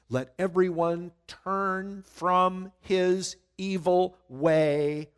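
slow, weighty reading of the decree; measured preacher reading; slow scripture reading. Acted. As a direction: dwell on every word.

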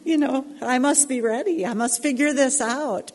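put-on high, loud little girl voice, eager. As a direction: play an earnest eight-year-old girl. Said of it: "that little eight-year-old girl, like".

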